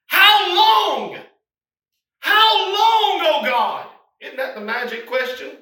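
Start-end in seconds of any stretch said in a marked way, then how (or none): 0.1-3.9 high, loud anguished cry of How long. Acted. Preacher voicing martyrs crying to God, loud anguished cry. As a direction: wail the question up to God. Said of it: "They're crying with a loud voice".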